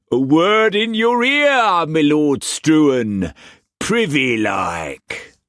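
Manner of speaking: high, slow sly pirate voice; cocky pirate. A narrator's reading. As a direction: lean in like a rogue with a secret. Pirate figure voice.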